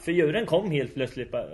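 ordinary talk

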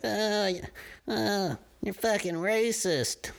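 high, slow whiny mock accuser; mocking quote. Mocking over-sensitive Americans, high and slow whiny accusation. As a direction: sneer it like a petty accuser calling names.

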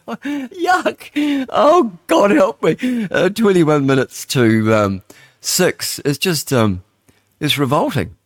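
high, squealed words through laughter; slow, high wail breaking with laughter; laughing disgust. Real, not acted. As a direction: struggle to speak while laughing. Disgusted, laughing through the time check.

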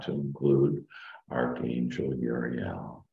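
slow, soft meditation voice; calm meditative tone. Acted. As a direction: ease them down into calm. Leads a guided meditation.

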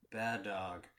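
put-on flat deadpan kid; monotone voice. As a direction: say it dead flat, like a bored kid. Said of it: "in a very monotone voice go".

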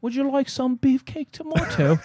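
high, slow, put-on British clerk asking "sir"; British shop clerk. Garden shop clerk offering tomatoes, mock British. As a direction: ham up a posh English seller. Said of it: "excuse me, sir".

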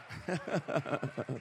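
low, soft chuckle. Real, not acted. Preacher pleased the room is going somewhere, low chuckle. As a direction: chuckle low after the big shout. Laughs after rousing the room.

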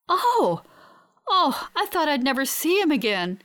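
high, emotional cry of relief; overwhelmed at a reunion, voice lifted high; overjoyed. A narrator's reading. Tearful joy at finding fiancé alive.

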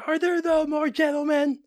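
high, loud mock-shrieking woman; screaming lady. Mimicking the lady on the bus video, high and loud. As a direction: ham up an outraged lady demanding a seat. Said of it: "She's like".

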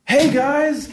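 squealed high greeting, hammed up; church emcee. Emcee opening after the sermon, very high mock-peppy greeting. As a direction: parody a gushing host at full pitch. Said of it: "M C is gonna do is something like this".

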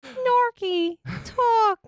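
high, slow squealing dolphin. Acted. Dolphin voice for podcast name.

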